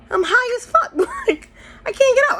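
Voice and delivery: half laughing, half crying in panic. Real, not acted. Stuck in the car, high, a squeaky laugh-cry.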